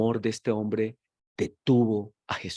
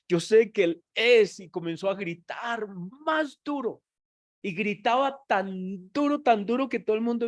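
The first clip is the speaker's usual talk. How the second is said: higher and louder, pushing faster to a peak; fervent preaching climax; 8 semitones higher, 3 dB louder than usual. Acted. Preacher acting out the blind man's cry, full force. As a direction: drive it to a shouting climax.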